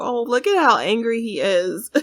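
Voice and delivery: ordinary talk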